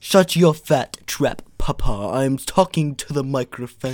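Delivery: loud, imperious cartoon baby; Stewie Griffin. Baby telling Papa to shut up, cartoon Stewie voice. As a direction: snap back like a haughty cartoon infant. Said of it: "Me being little Stewie Griffin".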